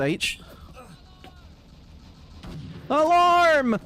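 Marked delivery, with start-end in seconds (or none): 2.9-3.8 high, loud, drawn-out call; shouted alarm. Pretend. Player calling out mid-game, shouted alarm. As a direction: cry out the alarm on one long note.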